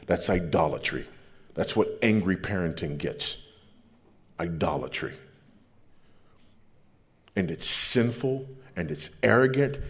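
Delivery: ordinary talk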